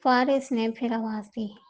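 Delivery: plain reading voice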